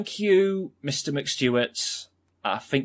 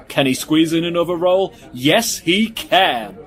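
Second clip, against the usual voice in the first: raised, louder play-by-play thrill, dragging out the answer; excited mock commentary; 5 semitones higher, 6 dB louder than usual. Commentator cheering on a bread-stuffing eater, excited.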